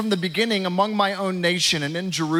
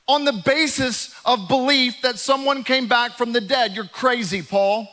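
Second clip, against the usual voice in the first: mock outraged shout, higher and louder; 5 semitones higher than usual. Festus shouting at Paul on trial, a higher, louder mock outrage. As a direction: bellow at him like he's lost his mind. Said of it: "he shouted with a loud voice".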